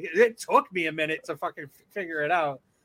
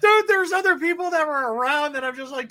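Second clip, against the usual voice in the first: usual speaking voice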